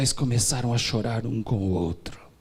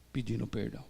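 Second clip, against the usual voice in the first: normal talking voice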